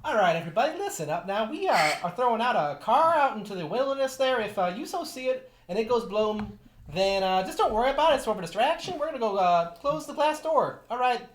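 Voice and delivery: loud folksy Southern gentleman. Pretend. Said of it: "[name] now sounds like a southern gentleman".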